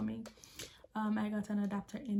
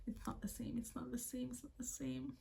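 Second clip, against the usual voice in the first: much softer, quick repeated undertone; hushed repetition; 2 semitones higher, 9 dB quieter than usual. Real, not acted. Vlogger warning newcomers about seasonings, hushed repetition. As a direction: repeat it under your breath.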